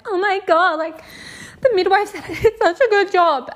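high, excited re-enacted squeal; retold thrilled delight. New mum hearing the midwife praised her, high thrilled delight. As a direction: gush it high, loud at the end.

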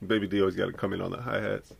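normal talking voice

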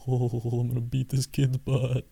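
soft, slow mock tough-guy voice; imagined thought of the customer glaring at the driver, soft and slow. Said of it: "my interpretation from in the car".

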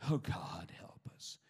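breathless whispered plea; hushed whisper. Retold or imitated. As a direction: whisper it as a desperate little prayer. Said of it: "I'll say".

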